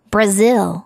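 Brazil with the weight on "zil"; stress on ZIL. Shown as a demonstration. Teacher models English pronunciation of Brazil, stress on ZIL. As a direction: punch the second syllable of "Brazil". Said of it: "zil 부분을 강하게 발음하면 돼요".

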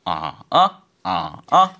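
"아" dipping very low then coming up; Mandarin third tone. Shown as a demonstration. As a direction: drop "아" to the floor and lift it. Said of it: "삼성은 의아해서".

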